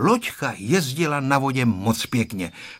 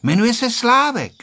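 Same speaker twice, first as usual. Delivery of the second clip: put-on high, louder triumphant girl; proud little-girl announcement, pitched up; 12 semitones higher, 5 dB louder than usual. A narrator's reading. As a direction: declare it victoriously in a high child's voice. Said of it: "řekla vítězně Tereska".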